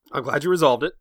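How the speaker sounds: ordinary talk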